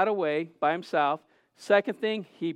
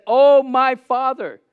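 high, loud, slow appeal; anguished cry; 6 semitones higher, 10 dB louder, slower than usual. Retold or imitated. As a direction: imitate a broken man crying out to his Father. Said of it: "he was so broken and weighed down".

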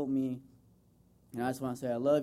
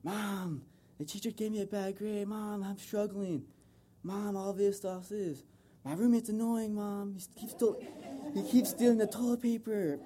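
whiny complaining; 7 semitones higher than usual. Retold or imitated. College son whining to his mom on the phone, high and whiny. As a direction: whine to Mom like a struggling freshman.

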